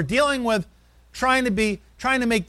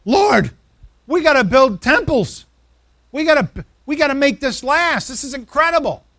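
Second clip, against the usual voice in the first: raised, louder, thrilled outburst; excited Peter; 5 semitones higher, 6 dB louder than usual. Retold or imitated. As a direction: blurt it out like Peter overwhelmed at the sight. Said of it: "He said".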